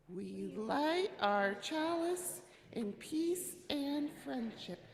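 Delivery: slow, high, ringing recitation; loud group recitation. Real, not acted. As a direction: say it out slowly for everyone to join. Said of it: "in our loud voices".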